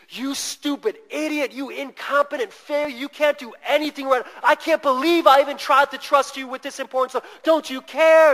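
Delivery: venomous tirade. Retold or imitated. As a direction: rail at him like a bride who has snapped. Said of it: "spewing all of this venom at him".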